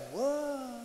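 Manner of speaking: awestruck whoa, drawn out; mock amazed whoa. Preacher mimicking a crowd impressed by manifestations, mock amazed. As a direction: feign being impressed. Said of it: "That is like".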